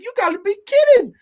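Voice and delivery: high, loud cry of disbelief. Retold or imitated. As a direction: shout it out in shock, sitting up in bed. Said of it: "I was like".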